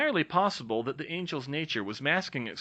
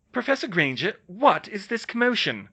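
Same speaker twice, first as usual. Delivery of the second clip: demanding; 8 semitones higher, 6 dB louder than usual. Acted. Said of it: "McGonagall demanded".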